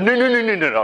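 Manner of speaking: high, rattled-off string of no's; comic refusal. Acted. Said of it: "came up to the microphone and said".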